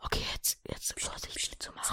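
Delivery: unvoiced hiding whisper. Hiding from police, whispering to his friend.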